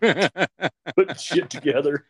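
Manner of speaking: slow, high, laughing through the words. Retold or imitated. Parent laughing about building toys drunk, slow and high. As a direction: chuckle it out at the memory.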